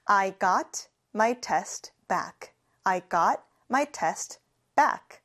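I got my test back, very slowly, each word separate; slow repeat-after-me. Shown as a demonstration. Teacher leads class repetition, very slow and clear. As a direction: spell it out slowly for learners. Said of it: "같이 해볼게요".

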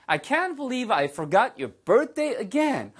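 teacher acting self-reproach in a model line; exasperated disbelief. Shown as a demonstration. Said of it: "이런 식의 느낌인 거죠".

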